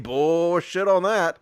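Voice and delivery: ordinary delivery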